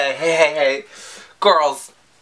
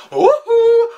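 woohoo much higher and slow, hooting; mock singer woohoo; 6 dB louder than usual. Imitating Ally's ad-lib for fans, high hooting woohoo. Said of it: "she going".

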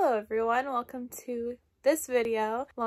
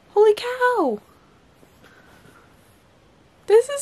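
high, loud astonished cry; genuinely stunned shout; amazed exclamation; 12 semitones higher, 8 dB louder than usual. Real, not acted. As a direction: blurt out real amazement. First look through new camera.

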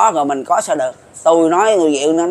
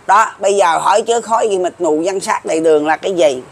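ordinary talk

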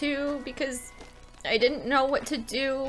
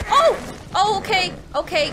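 high, loud yelp settling slightly; startled; 6 semitones higher, 6 dB louder than usual. Real, not acted. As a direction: yelp as the ground slips away. Startled by slide in game.

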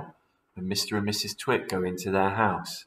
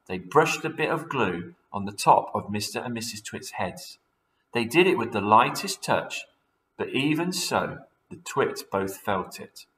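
ordinary delivery, nothing marked

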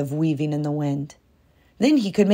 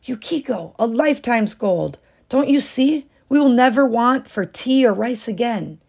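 higher, eager coaxing; eager persuading; 6 semitones higher than usual. A narrator's reading. Husband urging his wife toward gold, eager. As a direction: talk her into it, excited.

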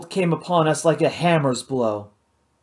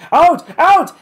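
loud, high barked commands; shouting; 12 semitones higher, 9 dB louder than usual. A narrator's reading. Hal drives the others out, loud barked shout. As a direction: bark it twice, full force. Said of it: "Hal shouted".

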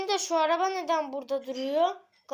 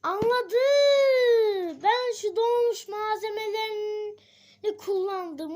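high, loud cry of discovery; sudden realisation; 6 semitones higher, 6 dB louder, slower than usual. Acted. Realising where the money went, crying out. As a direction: shout out the eureka moment.

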